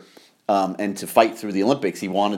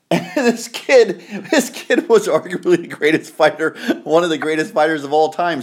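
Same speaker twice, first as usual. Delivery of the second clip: laughing; 6 semitones higher than usual. Real, not acted. Laughing disbelief at neglectful father.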